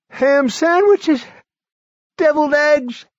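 put-on high, loud, strained old man; a feverish old man pushing through; sick old man. A narrator's reading. Barry voiced.

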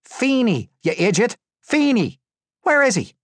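loud, very high yelling; furious high-pitched shouting; angry interrogation. A narrator's reading. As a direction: bellow the demand at him. Captor yelling after striking prisoner.

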